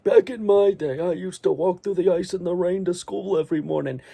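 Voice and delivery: mock a grumbling grandpa; old grandpa voice. Grandson mocking his grandpa's old stories, creaky old voice. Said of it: "your grandpa, like".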